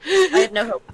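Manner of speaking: high, loud mock despair. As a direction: wail it in fake despair. Echo in a high voice.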